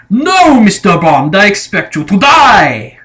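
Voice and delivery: put-on menacing German villain accent, savouring the threat; Goldfinger German accent. Reviewer quoting the villain's famous line in a German accent. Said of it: "In this Goldfinger accent that he does".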